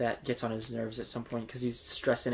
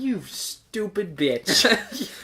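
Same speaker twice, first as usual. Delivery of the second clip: slow mock insult; 6 semitones higher, 5 dB louder, much slower than usual.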